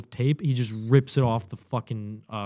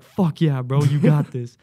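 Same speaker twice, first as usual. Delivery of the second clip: loud, raised-pitch pep talk; hyped encouragement; 3 semitones higher, 8 dB louder, slower than usual. Retold or imitated. Fire watch fist-bumping the recruit, hyped loud encouragement. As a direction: pump up your buddy.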